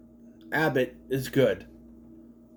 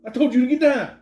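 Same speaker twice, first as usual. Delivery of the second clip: mock scolding; 11 semitones higher, 6 dB louder than usual. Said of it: "I'd be like".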